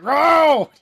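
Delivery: loud, high imitated Chewbacca roar; Wookiee roar. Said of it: "Chewie's in the fucking passenger seat".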